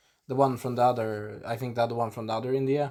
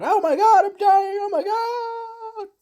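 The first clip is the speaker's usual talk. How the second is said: loud, very high hammed-up wail, fading in level on a drawn-out last cry; dying vampire screams; 4 dB louder, slower than usual. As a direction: shriek like a comically dying vampire. Acting out vampires being killed.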